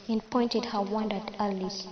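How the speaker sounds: ordinary delivery, nothing marked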